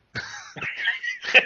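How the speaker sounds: airy giggle up high; high breathy laugh. Real, not acted. Laughing at his own impression.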